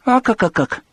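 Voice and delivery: comic grunting. A narrator's reading. Popeye's cartoon balloon.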